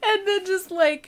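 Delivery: mock sob, pitched high; high tearful re-enactment; imitated crying. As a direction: squeak it out as if crying. Acting out crying on the bench.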